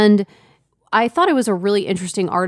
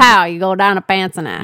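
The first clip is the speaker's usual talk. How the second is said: loud mock Pittsburghese; mock the Pittsburgh vowels, punched; Pittsburgh accent; 5 dB louder than usual. Partner joining the teasing, loud mock Pittsburgh accent. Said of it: "The Pittsburgh accent sounds like".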